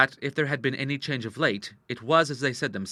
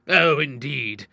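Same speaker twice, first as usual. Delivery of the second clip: slow, louder scornful drawl; sarcastic; 3 semitones higher, 5 dB louder, much slower than usual. A narrator's reading. Father scoffs at daughter's excuse, sarcastically. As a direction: draw it out with loud sarcasm. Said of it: "the doctor laughed sarcastically".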